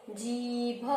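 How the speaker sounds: जीभ with a long held "jee" before the final "bh"; demonstrated whole word. Shown as a demonstration. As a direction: stretch the ई in जीभ. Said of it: "उसका उच्चारण क्या होता है". (जीभ में ई को लंबा खींचें)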